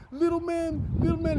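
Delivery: high, loud panicked kid, re-enacted; freaking out. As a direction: cry out to dad as a frightened child. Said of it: "freaking out, going".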